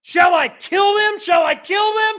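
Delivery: high, loud, eager pleading; eager kid. Acted. King of Israel asking Elisha, high and loud with eagerness. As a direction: beg like a kid in a candy store. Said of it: "like a little kid in a candy store".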